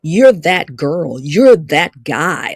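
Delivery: normal talking voice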